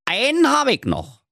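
high-pitched little dwarf; cheeky ghost dwarf. Acted. Dwarf ghost has another memory for the miser, cheeky.

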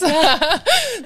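high laugh falling away; short burst of high laughter; bright laugh. Real, not acted. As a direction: laugh at your own story. Laugh after her quote.

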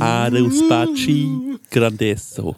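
slow made-up Italian phrase; fake Italian. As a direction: croon mock Italian slowly. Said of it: "einfach so in Fantasie-Italienisch".

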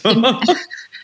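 bright burst of laughter; real laugh. Real, not acted. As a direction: laugh out at the joke. Laughs at three-year joke.

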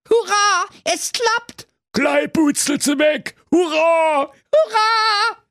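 high, jubilant royal cheer; shrill cheering parents. Acted. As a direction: squeal it with delight. King and queen cheer.

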